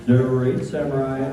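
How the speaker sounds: usual speaking voice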